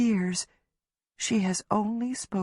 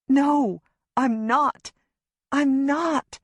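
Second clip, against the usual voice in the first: frightened denial; 7 semitones higher, much slower than usual. A narrator's reading. Denying, frightened.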